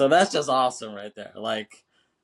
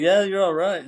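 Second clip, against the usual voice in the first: higher, louder imitation of faint praise; offhand approval; 8 semitones higher, 5 dB louder than usual. Newcomer rating the band he watched play, mock offhand approval. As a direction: toss off a retold "you're all right". Retold cool verdict on the band.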